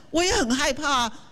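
normal talking voice

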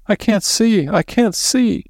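high, whimpering wail. A narrator's reading. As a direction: wail in panic. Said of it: "She was whimpering and wailing".

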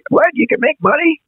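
high, faux-astonished question; hammed-up amazement; mock surprise. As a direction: act like you just found out. Mock realization about money.

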